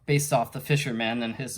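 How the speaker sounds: usual speaking voice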